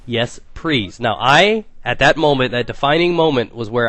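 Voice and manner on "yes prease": "please" drawn out as "prease", R for L; L turned to R. Retold or imitated. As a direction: quote the slip, "please" as "prease". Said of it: "and I quote".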